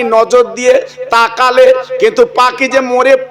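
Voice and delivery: lilting, chanted preacher's telling; sermon storytelling. Acted. Preacher telling the crowd a saint's tale, chanted sermon voice.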